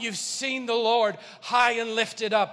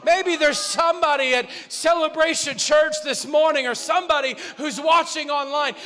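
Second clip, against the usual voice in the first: rising, louder preaching; building sermon; 5 semitones higher, 6 dB louder than usual. Real, not acted. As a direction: build the sermon to a fever pitch.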